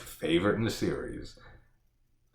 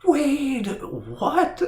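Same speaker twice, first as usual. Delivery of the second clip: mock scream; much slower than usual. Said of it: "he's screaming".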